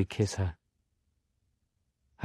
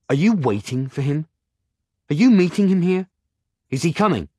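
rising angry questions; louder, higher accusing demand; 7 semitones higher, 6 dB louder than usual. Acted. Simon accuses Agatha.

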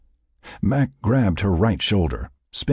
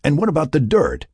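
higher, insistent challenge; pressing, argumentative interruption; interrupting; 10 semitones higher, 4 dB louder than usual. A narrator's reading. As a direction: cut in with a pointed question. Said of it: "he interrupted".

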